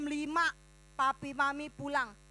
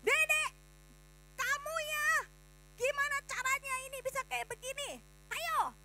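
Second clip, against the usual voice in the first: high, fast, scolding parent voice, mock angry; 11 semitones higher than usual. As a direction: play a cross mum scolding her child. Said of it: "Mustinya dia gini". (omelan orang tua yang dibuat-buat, tinggi dan cepat)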